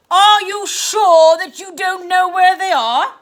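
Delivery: aunt interrogating her niece; menacing question. Acted. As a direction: lean in with suspicion.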